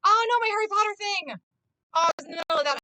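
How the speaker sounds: high, loud panicked cry of dismay; sudden loud panic; alarmed cry. Real, not acted. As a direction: yelp in real alarm over the lost streak. Lost game streak panic.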